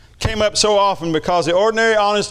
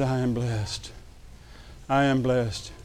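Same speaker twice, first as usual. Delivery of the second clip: hushed awe; 5 semitones lower, 10 dB quieter, slower than usual. Retold or imitated. Overwhelmed reply to God.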